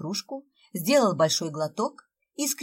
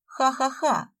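icy fake laugh; 6 semitones higher, slower than usual. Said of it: "ледяным тоном сказала Света".

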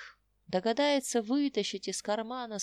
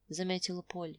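ordinary delivery, nothing marked

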